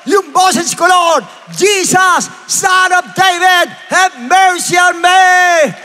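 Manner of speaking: high, drawn-out shouted plea; crying out. Shown as a demonstration. Preacher shows believers how to call on God, shouting. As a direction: shout to the Lord at full voice. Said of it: "sisigaw ka".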